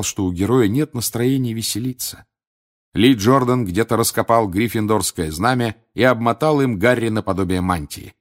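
plain reading voice

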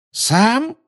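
high, incredulous shriek of a question; high-pitched scornful outcry; piercing exclamation. A narrator's reading. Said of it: "пронзительно воскликнула Белатриса".

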